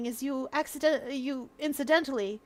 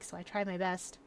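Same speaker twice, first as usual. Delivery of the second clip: normal talking voice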